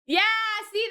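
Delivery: usual speaking voice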